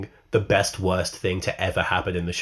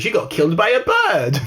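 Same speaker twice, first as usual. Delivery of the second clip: high, laughing showman's delivery; hammed-up high puppeteer voice; Toymaker puppet-show impression; 11 semitones higher, 3 dB louder than usual. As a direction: announce it theatrically while cracking up.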